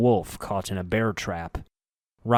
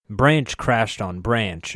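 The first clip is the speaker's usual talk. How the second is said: usual speaking voice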